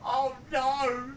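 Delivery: high wailing oh no; mock dismay. Real, not acted. Streamer mourns his first grey hair, mock dismay. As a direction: sound crushed, half joking.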